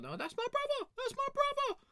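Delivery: extremely high, squealed protest; squealing mock panic. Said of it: "I was like".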